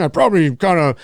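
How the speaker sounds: normal talking voice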